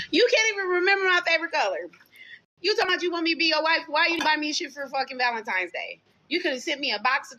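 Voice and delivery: fast, high-pitched retold tirade; retelling how she went off on him, fast and high; heated rant. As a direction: rattle it off, worked up. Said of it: "I was like".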